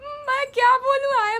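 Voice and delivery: high, loud and slow, dragged out in shock; stunned disbelief. Real, not acted. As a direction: speechless at the creep. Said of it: "I am speechless भाई".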